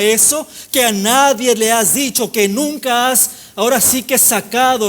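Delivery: insistent preacher hammering each repeated phrase; impassioned preaching. Real, not acted. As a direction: preach with driving, sustained energy. Preaches at full force.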